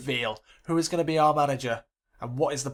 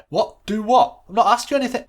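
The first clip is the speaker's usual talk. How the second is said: baffled assistant; 8 semitones higher, 9 dB louder than usual. Acted.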